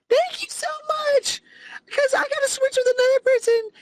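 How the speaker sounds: very high, whiny put-on coworker voice; mock tearful gratitude. Coworker thanking someone for taking her shift, mock tearful squeak. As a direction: squeak out exaggerated, weepy thanks. Mocks coworker's grateful reply.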